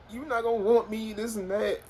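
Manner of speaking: high mock-weepy voice; imitating sobbing girlfriend. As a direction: girlfriend fears he won't want her, high and mock-weepy. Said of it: "she get to breaking down, crying even more".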